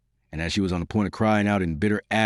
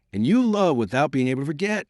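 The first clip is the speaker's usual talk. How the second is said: higher and fast, a mild pained exclaim; pained exclamation; 7 semitones higher, faster than usual. A narrator's reading. Raoul questioning the girl who loves another, mild pained exclaim. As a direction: press the question out in hurt. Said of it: "Raoul uttered a deep sigh as he exclaimed".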